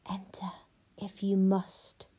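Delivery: slow, whispery and menacing; terrible voice. Acted. Witch answers the knock, terrible whispered voice. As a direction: whisper it slowly, with dread menace. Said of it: "A terrible voice answered her".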